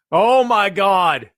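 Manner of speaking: drawn-out, very slow loud shout; booming slow disbelief. Pretend. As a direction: stretch it out and yell in amazement. Awed reaction to a promo.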